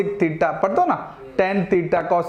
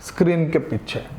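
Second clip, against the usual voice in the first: mysterious hush; 6 semitones lower than usual. Shown as a demonstration. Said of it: "किसके पीछे?".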